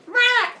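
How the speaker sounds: high, loud burst of noise; imitated baby squawk. Baby drops onto the ground, loud squawk. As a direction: let out a comic high squawk.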